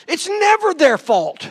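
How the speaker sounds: loud, high sermon cry; a fiery pulpit shout; full-force preaching. Acted. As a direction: preach it hard to the congregation.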